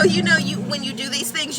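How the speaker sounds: normal talking voice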